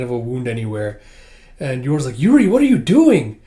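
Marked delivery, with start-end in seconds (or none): 2.2-3.4 high, loud, indignant question; startled protest. Retold or imitated. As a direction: call out at your brother in dismay. Yor startled by brother grabbing Lloyd.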